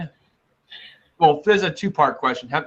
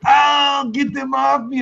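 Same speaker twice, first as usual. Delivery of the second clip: Arnold yelling; 7 semitones higher, slower than usual. Retold or imitated. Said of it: "and you're just like".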